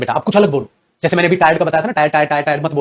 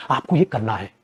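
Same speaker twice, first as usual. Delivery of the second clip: softer, dropped-down take of आपको ये करना है; quiet; 8 semitones lower, 9 dB quieter, slower than usual. Shown as a demonstration. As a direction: say आपको ये करना है softly, almost to yourself. Teacher repeats instruction. (आपको ये करना है धीमी नरम आवाज़ में)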